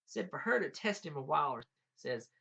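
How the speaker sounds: usual speaking voice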